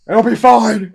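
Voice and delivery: high, very loud holler; big high-pitched confident shout; shouted reassurance. Acted. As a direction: yell it over everyone's heads. Xander calls out, riding up.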